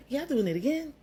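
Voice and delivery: high, exasperated friend voice; teasing friend. Retold or imitated. Friend JoJo knocking on the door, high exasperated tease.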